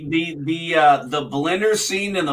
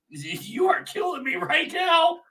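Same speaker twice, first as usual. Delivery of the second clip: real laughter, pitched way up; laughing hard; 14 semitones higher, slower than usual. Real, not acted. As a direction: crack up through every word. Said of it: "That's why I'm laughing so hard".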